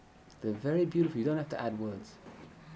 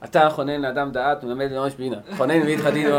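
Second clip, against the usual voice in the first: mock rushed rattling prayer; 8 dB louder, much faster than usual. Shown as a demonstration. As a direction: rattle the prayer off fast and loud, no feeling. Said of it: "That's how you speak".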